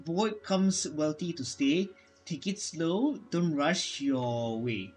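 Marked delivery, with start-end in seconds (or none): none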